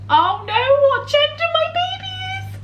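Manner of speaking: high, bursting with excitement; barely containing her joy; excited. Real, not acted. Mum-to-be telling viewers about the gender scan, loud and high with excitement. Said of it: "so, so excited".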